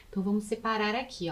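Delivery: ordinary talk, nothing marked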